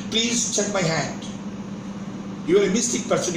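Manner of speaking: usual speaking voice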